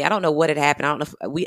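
ordinary delivery

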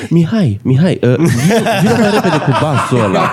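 mock bandleader calling out. Imagined bandleader calling bassist.

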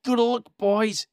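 high, loud cry of goodbye; cried farewell. A narrator's reading. Lorax sending the barbaloots away, a high loud cry. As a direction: call out to the barbaloots as they leave. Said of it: "he cried".

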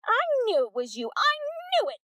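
loud, high triumphant accusation; accusing outburst. Acted. Peg accusing Missus Wack at the door, triumphant. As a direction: catch her red-handed.

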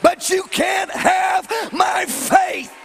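loud, slow, climbing preacher's cry; fiery preaching. Acted. Preacher rallying the congregation, loud and defiant. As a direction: build it up and defy Satan.

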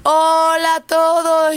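big, slow, drawn-out hello; excited greeting. Real, not acted. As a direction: open the show with a bright call. Cheerful show opening greeting.

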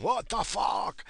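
high, drawn-out mock disbelief; mimicked VP outburst. VP swearing first in the boardroom, high drawn-out mimicry. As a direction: stretch it out like an exasperated executive. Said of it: "it's not the friggin' V P saying".

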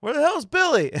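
high, loud mock yell; shouting for Billy. Character calling for the absent Billy, high loud mock yell. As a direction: bellow it into the empty room. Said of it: "He goes".

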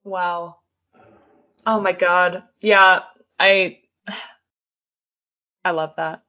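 ordinary talk

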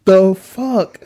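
high, loud and drawn out; stunned disbelief. Retold or imitated. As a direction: drag each word out in loud disbelief. Retold shocked reaction to cringe interview.

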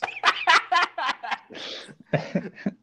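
laughing hard, high squeal; high shrieking laugh. Real, not acted. Cracking up at a friend's failed accent, high shrieking laugh. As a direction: let the laugh break out high. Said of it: "I was laughing so hard".

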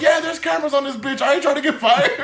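high-pitched protest through laughter; mock indignant. Bus driver explains refusal to passenger, mock indignant. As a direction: protest it high, hammed-up and amused.

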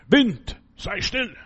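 sharp high command to the wind; commanding shout. Acted. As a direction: order the storm to be still.

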